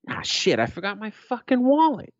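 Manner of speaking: frustrated self-curse, pitched high on the wallet; exasperated outburst. Retold or imitated. Dad realizing he has no money for Starbucks, exasperated. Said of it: "This is exactly what I said".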